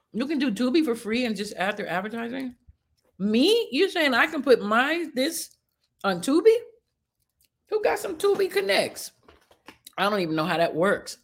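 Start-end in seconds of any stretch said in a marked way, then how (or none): 3.2-9.1 high, eager disbelief; thrilled and amazed; excited. Real, not acted. Excited about getting on Tubi.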